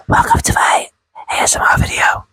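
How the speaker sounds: whispered ASMR intro; mock ASMR whisper. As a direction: breathe it into the mic like an ASMR host. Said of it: "ASMR video".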